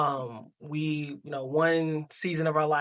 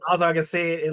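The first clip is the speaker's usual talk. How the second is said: usual speaking voice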